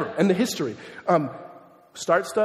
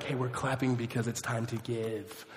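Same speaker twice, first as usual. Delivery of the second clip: soft, slow, lower aside; imitated churchgoer explaining; 6 semitones lower, 8 dB quieter than usual. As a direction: explain it slowly and low, as a mock confidant. Churchgoer explains the clapping to him.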